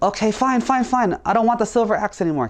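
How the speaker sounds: high, huffy giving-in; exasperated surrender. Acted. Dishonest woodcutter gives up on the silver axe, exasperated.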